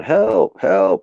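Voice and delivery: imitating a trapped voice calling for help; cry for help. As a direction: call out like a trapped person. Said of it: "You can hear somebody going".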